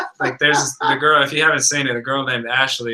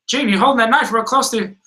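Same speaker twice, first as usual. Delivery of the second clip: high, fast fussing voice; Jimmy Hart; 8 semitones higher, faster than usual. Retold or imitated. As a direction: imitate a nagging onlooker. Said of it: "And then he's like".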